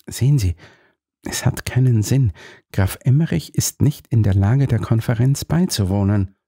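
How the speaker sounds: usual speaking voice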